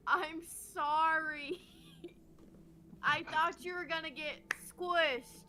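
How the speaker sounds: slow, stretched-out words; badly spoken apology. Player badly apologizes to the gnome, slow halting speech. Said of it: "I'm just gonna badly be like".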